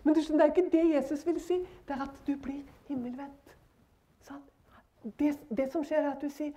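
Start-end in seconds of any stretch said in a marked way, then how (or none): none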